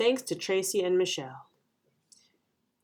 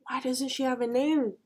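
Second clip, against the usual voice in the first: ordinary delivery, nothing marked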